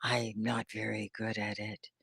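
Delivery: low, soft, defeated mimicry; mock self-doubt under your breath; learner's self-doubt. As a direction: imitate a discouraged student, dropped right down. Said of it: "if you're saying".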